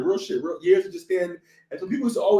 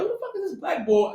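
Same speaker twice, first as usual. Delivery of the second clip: high-pitched puzzled quote; lightly acted, high incredulous question; mock bewilderment; 11 semitones higher, 3 dB quieter than usual. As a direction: act out spotting an unexpected stranger. Acts out spotting a stranger.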